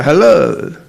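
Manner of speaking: ordinary talk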